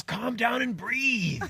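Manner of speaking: high, slow, drawn-out urging; coaching rooster. Acted. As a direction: coach a panicking layer, stretching each word. Rooster coaches the egg layer.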